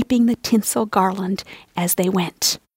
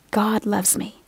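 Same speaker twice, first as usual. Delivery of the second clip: softer still, fading gentle repetition; soft repeated mantra; 6 dB quieter, slower than usual. Shown as a demonstration. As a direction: let the second one settle down quietly. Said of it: "say over and over".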